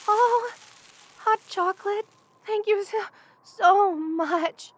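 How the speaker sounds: delighted, stammering from the cold; shivering delighted gratitude. Acted. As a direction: gush with thanks while still shivering. Freezing, handed hot chocolate.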